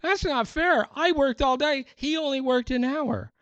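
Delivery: high whining protest of a cheated worker; whiny worker complaint. Retold or imitated. Worker who toiled all day whines to the landowner. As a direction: whine like a child denied his fair share. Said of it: "the guys that worked all day said".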